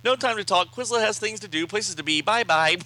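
high, loud sing-out brush-off; busy cartoon character. Quizlet dodging Dream Girl, high and breezy. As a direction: pipe it out high, ending on a loud goodbye.

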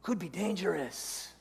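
soft, casual tempting voice; devil on the shoulder. Acted. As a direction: quietly talk them out of it. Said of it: "then the second voice chimes in".

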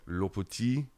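badly faked French accent; clumsy, put-on French accent; terrible French accent. Shown as a demonstration. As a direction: attempt French pronunciation in a bad French accent. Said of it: "here's my terrible French accent".

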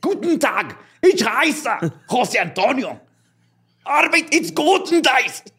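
slow, high mock German accent; hammed-up German accent introducing himself. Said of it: "cuando habla como alemán".

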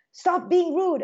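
high, hammed-up scold; mock scolding parent. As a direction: imitate a nagging parent. Parent voiced scolding kid.

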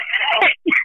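a sudden high whooping laugh; real laugh at the absurd question. Real, not acted.

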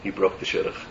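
hushed, breathy secret; whispered reveal. Acted. Preacher revealing the rabbi broke the engagement, whispering. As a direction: drop to a whisper for the twist. Dramatic reveal of the story's twist.

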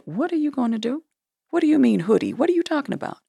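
imitating a startled boyfriend, pitched well up; incredulous questioning. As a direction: put on high-pitched, baffled questions as the boyfriend. Boyfriend's alarmed questions, acted in retelling.

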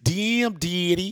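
loud, very drawn-out joke catchphrase; mock slang voice. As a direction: stretch both words out for the bit. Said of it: "the [name] victims used to talk".

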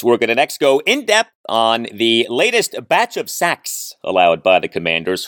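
ordinary delivery

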